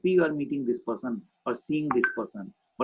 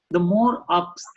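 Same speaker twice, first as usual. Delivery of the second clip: "more ups" lifted high and louder; pitched up; 5 semitones higher, 7 dB louder, slower than usual. Shown as a demonstration. Trainer acting out rising pitch, high and loud. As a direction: climb up on the word ups. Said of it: "So what is nice?".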